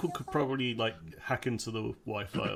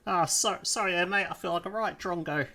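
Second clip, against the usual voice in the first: Kiwi elf voice; 6 semitones higher than usual. Acted. Puddin apologises in character.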